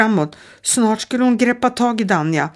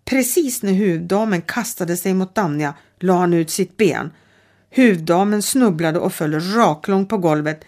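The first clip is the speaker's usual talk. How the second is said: ordinary delivery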